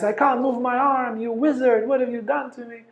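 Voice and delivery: high, frightened accusing voice; panicked accusation. Retold or imitated. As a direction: cry out in panic at the wizard. Frozen attacker accuses the master.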